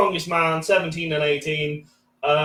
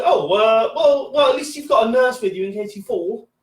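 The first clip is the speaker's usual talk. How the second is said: put-on patronising old lady; 5 semitones higher than usual. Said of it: "she goes".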